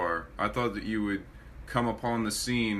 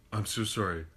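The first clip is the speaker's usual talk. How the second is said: ordinary talk, nothing marked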